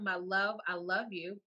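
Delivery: usual speaking voice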